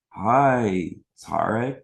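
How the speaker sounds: mock sweet, slow kid-talk; sing-song talking down to an odd kid; high sugary talk to a stranger; talking down to an alien. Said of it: "they literally would talk to me like I was an alien".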